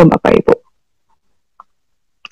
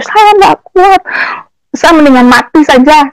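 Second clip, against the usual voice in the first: desperate plea, pitched up; 6 semitones higher than usual. Retold or imitated. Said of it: "saya hanya bisa menangis".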